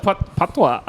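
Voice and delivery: put-on half-laughing go at a Jamaican accent; attempted Jamaican accent. Co-host asked to say it with an accent, tentative mock Jamaican accent. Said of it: "Say it with an accent".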